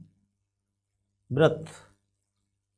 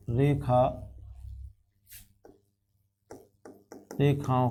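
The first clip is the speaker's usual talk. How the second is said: very slow, low, word-by-word dictation; dictating while writing; 4 semitones lower, 7 dB quieter, much slower than usual. Real, not acted. Teacher writing the construction statement for students, slow dictation. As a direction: leave a long pause after every word or two, voice dropped.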